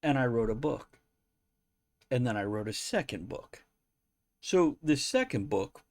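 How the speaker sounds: ordinary delivery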